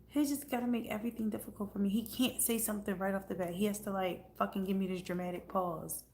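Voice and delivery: ordinary talk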